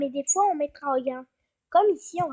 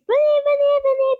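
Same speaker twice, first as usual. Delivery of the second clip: "Venez, venez" called out high and loud, beckoning; 11 semitones higher, 5 dB louder than usual. Acted. A house that seems to beckon visitors, calling out high and loud. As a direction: lure them in with a bright, loud call. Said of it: "Ça fait comme si on disait".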